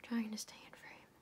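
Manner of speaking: soft unvoiced whisper. Real, not acted. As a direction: whisper so the room stays quiet. Whispers, notes it is quiet.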